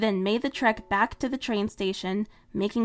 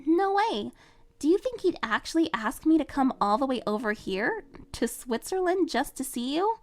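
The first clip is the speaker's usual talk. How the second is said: put-on higher, bright teen girl voice; young heroine, lightly raised and eager; Marinette voice; 5 semitones higher than usual. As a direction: voice the cheerful heroine. Marinette's voice in read story.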